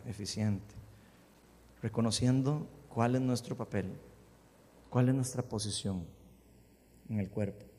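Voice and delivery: hushed, low-pitched congregational prayer. Real, not acted. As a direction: pray earnestly, pausing between petitions. Leads a prayer. (oración en voz baja y pausada)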